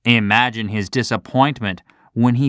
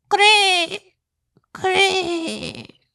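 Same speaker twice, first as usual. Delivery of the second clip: very high faint cricket cry; weak dying chirp. Acted. Crushed cricket crying out as it dies, weak high chirp. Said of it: "It cried ... weakly".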